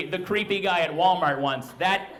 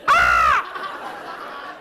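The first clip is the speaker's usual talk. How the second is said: loud imitated kid squawk; child's scream; 4 semitones lower, 4 dB louder than usual. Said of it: "Why are we screaming?".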